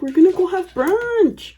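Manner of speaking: mock the brunch-goers, drawing out the last word; a hint of teasing imitation; mocking mimicry. Mimicking the relatives.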